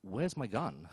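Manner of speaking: soft, low muttered thought; inner thought. Retold or imitated. Alone with a robot camera, soft low muttered thought. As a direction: mutter it to yourself. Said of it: "I'm thinking".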